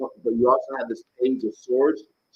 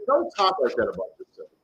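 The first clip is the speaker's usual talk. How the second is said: ordinary talk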